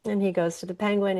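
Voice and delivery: ordinary talk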